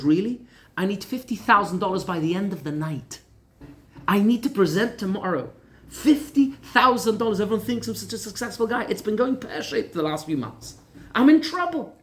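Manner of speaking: cornered man finally cracks, loud and high; losing it. Retold or imitated. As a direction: blurt out the debt in panic. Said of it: "And the guy lost it".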